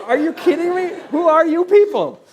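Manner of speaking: loud, high-pitched disbelief; hammed-up astonishment, voice shooting way up; incredulous. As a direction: imitate a flabbergasted guest crying out. Guest stunned by the waiters' generosity.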